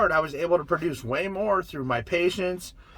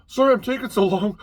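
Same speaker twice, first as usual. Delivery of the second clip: imitating apologetic stranger; 6 semitones higher, 5 dB louder than usual. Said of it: "they're like".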